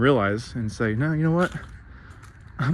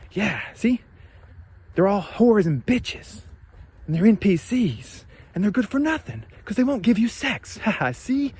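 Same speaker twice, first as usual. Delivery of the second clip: hammed-up smug gloating, much higher; mocking smug ranter; 9 semitones higher than usual. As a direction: sneer it out like a smug zealot. Mocking a ranting voice.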